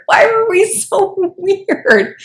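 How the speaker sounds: slow, high whine through laughter. Real, not acted. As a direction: drag it out, cracking up. Laughing at emo past self.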